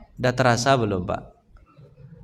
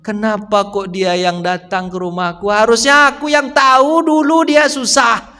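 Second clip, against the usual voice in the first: raised, slowed, pained remorse; Umar lamenting; 7 semitones higher, 3 dB louder than usual. Retold or imitated. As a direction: lament your own failure aloud. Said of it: "Apa kata Umar".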